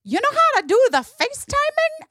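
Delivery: put-on high, chatty little kid voice; little boy voice. Nephew tells his aunt what he's up to, in a high kid voice. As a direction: play a five-year-old wandering the house. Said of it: "he goes".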